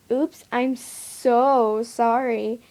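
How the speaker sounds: sarcastic, drawn-out sorry. A narrator's reading. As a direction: drag the apology out, not meaning it. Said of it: "Jack said sarcastically".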